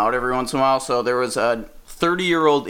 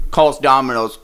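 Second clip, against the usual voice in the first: ordinary talk, nothing marked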